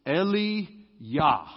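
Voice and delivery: "Eliyah" with a y sound, not j; Hebrew pronunciation. Shown as a demonstration. Pastor explaining the name, "Eliyah" with y. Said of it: "Elijah is".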